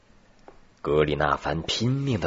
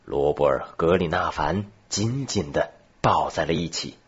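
usual speaking voice

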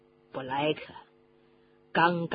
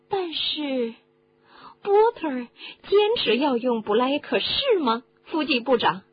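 high-pitched, prying questioner; inquisitive listener; 7 semitones higher, 4 dB louder than usual. Acted. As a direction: press for the answer in a high character voice. Voices a questioning listener in the story. (高声追问的角色)

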